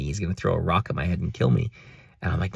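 normal talking voice

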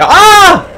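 loud, high-pitched yelp; startled scream. Real, not acted. Player jumps at horror game, startled scream. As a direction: scream out at a sudden scare.

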